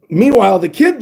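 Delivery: ordinary talk, nothing marked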